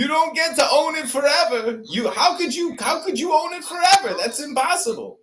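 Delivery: high, pretend shouting accusation; mock outrage. Troll fakes outraged accusation.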